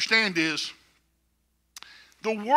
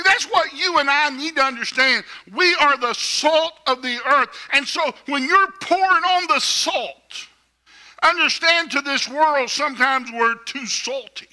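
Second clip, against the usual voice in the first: ordinary delivery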